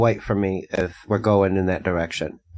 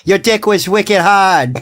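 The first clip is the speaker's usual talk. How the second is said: higher, hammed-up Boston twang; Boston lady accent; 5 semitones higher, 4 dB louder than usual. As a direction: play a broad Boston local calling out. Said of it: "the Boston lady accent".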